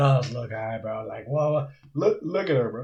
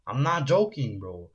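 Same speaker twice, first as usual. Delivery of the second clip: ordinary delivery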